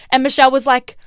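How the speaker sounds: ordinary talk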